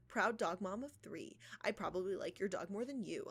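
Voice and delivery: ordinary talk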